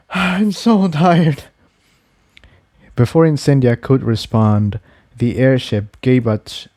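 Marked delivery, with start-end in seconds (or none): 0.0-1.4 very slow, drawn-out and drained; worn-out sigh. Acted. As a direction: let every word sag with exhaustion. Sighs, then admits exhaustion.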